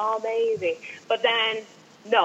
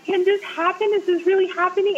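high-pitched retold amazement; stunned disbelief; 9 semitones higher than usual. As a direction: replay your starstruck disbelief, voice lifted. Retells her stunned disbelief upstairs.

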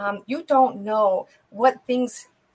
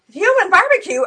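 loud, high, incredulous repeat; indignant echo; 9 semitones higher, 7 dB louder than usual. Retold or imitated. Woman challenges the Satanist's joke about hell, indignant. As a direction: throw the joke back in outrage. Retold appalled retort to his joke.

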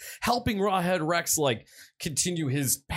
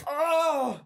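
high, drawn-out moans of rapture; mock ecstasy; 11 semitones higher, much slower than usual. Host playing the doused priest, ecstatic moaning. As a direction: wail it in hammed-up bliss. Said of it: "the priest is like".

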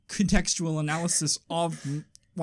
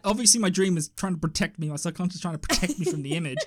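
usual speaking voice

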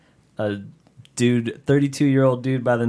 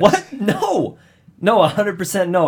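high, loud denial; indignant protest; 9 semitones higher, 5 dB louder than usual. Real, not acted. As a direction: insist it's not true. Protesting accusation loudly.

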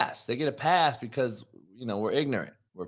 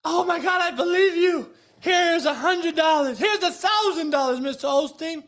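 much higher, gushing mock believer; pitched-up mock fan of the preacher; gushing worshipper; 12 semitones higher than usual. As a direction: squeal praise like a starstruck churchgoer. Mock worshipper handing over money.

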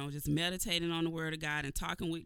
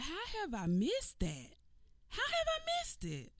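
amazed self-question; 12 semitones higher, 4 dB quieter than usual. Retold or imitated. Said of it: "I was like".

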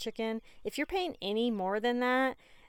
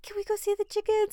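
put-on eager child; 11 semitones higher, faster than usual. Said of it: "she's like".

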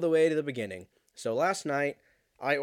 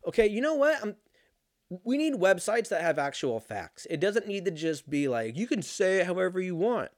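higher-pitched, indignant complaint; fed up, voice pitched up; exasperated rant; 7 semitones higher, 4 dB louder than usual. Real, not acted. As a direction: rant in exasperation at useless information. Exasperated rant at unhelpful websites.

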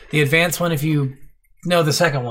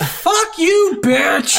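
much higher, hammed-up comeback; mock angry retort; 4 dB louder, much slower than usual. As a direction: snap back in jest at the ungrateful sage. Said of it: "And I'm like".

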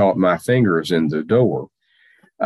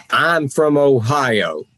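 slow, pitched up and proud like a catchphrase; proud slogan; 7 semitones higher, slower than usual. Shown as a demonstration. Grant launching his own catchphrase for the host, proudly. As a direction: coin it as a motto, savoring each word. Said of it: "I'd like to start another expression".

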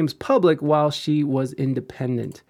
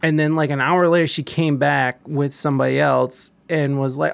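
ordinary talk, nothing marked

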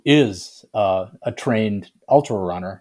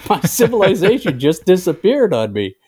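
higher, hammed-up despair of a fallen civilization's citizen, laughing through it; mock lament; 9 semitones higher, 5 dB louder than usual. Host imitating people of a collapsing empire, mock despair. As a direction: play a bewildered ancient losing everything, half-joking. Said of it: "they would have gone".

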